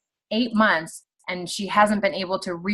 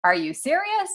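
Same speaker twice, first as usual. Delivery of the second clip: pitched way up in disbelief; incredulous; 9 semitones higher than usual. Retold or imitated. Incredulous, much higher, scoffing at a lunch offer. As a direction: act stunned at the offer. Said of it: "I'm like".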